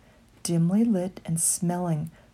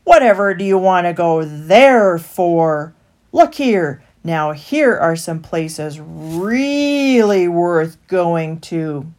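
louder, slow wheedling rat; sly stallholder; 9 dB louder, slower than usual. A narrator's reading. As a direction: sell it to them.

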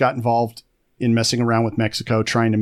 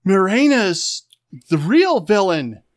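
crazy NPC; 7 semitones higher, 4 dB louder, slower than usual. Retold or imitated. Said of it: "they're gonna say something like".

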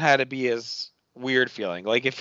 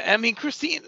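ordinary talk

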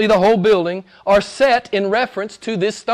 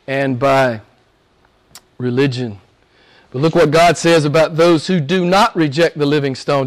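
ordinary talk, nothing marked

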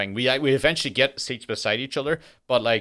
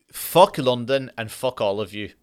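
usual speaking voice

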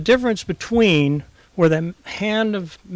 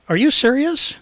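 high, disbelieving question; mock Ron asking if he means it; incredulous; 8 semitones higher than usual. Quoting Ron, high disbelieving question. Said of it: "one of those Ron looks like".

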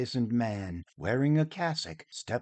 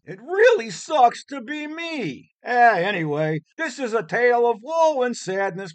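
high, loud, fed-up complaining; casual whiny actor voice; 9 semitones higher, 6 dB louder than usual. Acted. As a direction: whine it like a bored bit-part actor. Prologus drops act, casual complaining.

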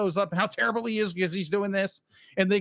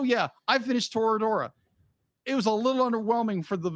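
ordinary talk

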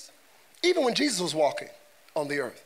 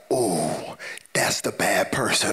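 low-pitched wary movie watcher; mock ominous warning; 9 semitones lower than usual. Preacher as a moviegoer spotting the villain, mock ominous. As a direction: warn them off, voice dropped low.

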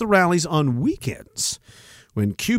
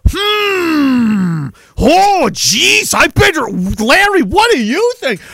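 mock dithering voice; 10 semitones higher, slower than usual. Mock character turning to Larry for advice, higher. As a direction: play a flustered guy asking Larry.

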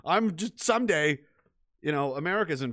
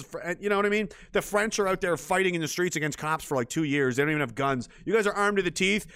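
ordinary talk, nothing marked

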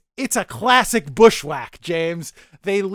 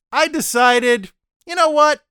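defiant outburst; 7 semitones higher, 4 dB louder than usual. Retold or imitated. Zheban's defiant turn.

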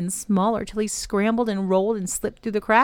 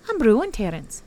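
ordinary delivery, nothing marked

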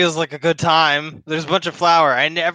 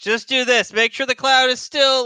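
higher-pitched, emphatic instructions; instructing in character; 9 semitones higher than usual. Acted. Character hands over tinderbox, urgent high-pitched instructing. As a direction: coach a partner through it, voice raised in pitch.

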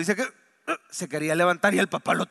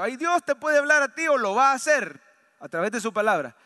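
full-force preaching; 4 semitones higher, 5 dB louder than usual. Acted. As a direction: preach it out over the congregation.

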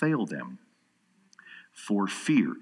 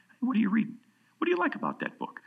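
high, bright mock-casual asking voice; cheerful light questioning, much higher; friendly curious question; 11 semitones higher, faster than usual. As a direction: play a friendly stranger asking about the book. Acting out friendly question to stranger.

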